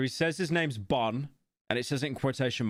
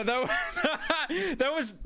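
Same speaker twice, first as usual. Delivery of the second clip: laughing denial; much slower than usual. Real, not acted. Denying the voice crack.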